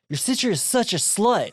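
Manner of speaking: put-on high, sneering teen brat; mock disgusted teen. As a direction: sneer it like a bratty kid brother. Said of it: "the way he says it".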